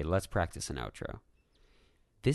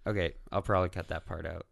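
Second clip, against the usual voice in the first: ordinary talk, nothing marked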